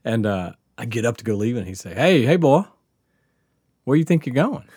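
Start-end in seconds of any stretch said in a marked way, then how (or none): 1.9-4.6 higher, louder call to a customer; lightly voiced barber's challenge. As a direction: call out a retold challenge to someone leaving. Armed barber stops departing customer.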